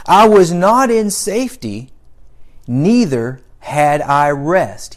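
loud, emphatic Bible reading; scripture reading. Acted. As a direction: proclaim the verse to the congregation. Reads scripture aloud to congregation.

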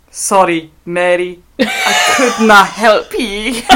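hammy Scottish, slowing right down at the end; Scottish accent. Acted. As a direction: read it as a bad comic Scot. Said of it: "put that little accent in there".

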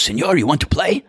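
put-on eager, high Spanish-accented question. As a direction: lights up and asks in a Spanish accent, pitched high. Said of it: "speaking with a Spanish accent".

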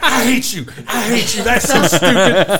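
high-pitched imitation of a furious coworker; mock outrage. Imitating Jane falling for the prank, so mad. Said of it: "she was so mad".

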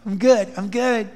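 bright, falsely cheerful brush-off; forced faith smile. Retold or imitated. Preacher imitating a believer faking being fine, forced cheer. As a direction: put on a brave smiling front. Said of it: "the faith smile on".

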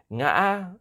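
"ngã" catching mid-word and jumping up high; broken rising tone. Shown as a demonstration. Host explaining a hard tone, "ngã" hacked and rising high. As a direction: hack "ngã" in the middle, then send it up. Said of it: "goes up a little bit".

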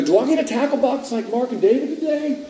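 high-pitched imitated child's question; pleading kid. Boy asks mother about an Easter tackle box, pleading kid. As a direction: ask high and eager, like a little boy. Boy begs through bathroom door.

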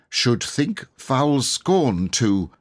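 slow and deliberate; slowly. Acted. Boy pleads with the knight, slowly. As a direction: drag it out as he thinks. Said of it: "he said slowly".

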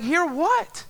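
high, rising incredulous question; retold exasperation. Boyfriend answering girlfriend during movie, mock exasperation.

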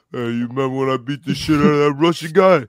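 put-on tough-boxer impression; Rocky Balboa. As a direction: play the movie boxer making his pitch. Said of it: "like, Rocky Balboa, Sylvester Stallone".

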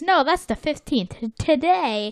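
high-pitched eager cartoon voice; SpongeBob. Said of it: "SpongeBob says".

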